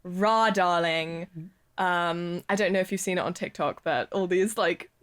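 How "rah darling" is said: slow drawled posh British "Rah, darling"; exaggerated posh British drawl. Shown as a demonstration. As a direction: stretch it out like a posh British schoolgirl. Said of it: "affectation that people would take on".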